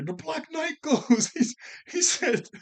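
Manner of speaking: high, loud, slowed by laughter; real laughing. Real, not acted. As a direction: crack up while listing absurd attacks. Cracks up listing absurd attacks.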